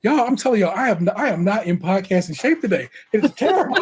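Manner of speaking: ordinary delivery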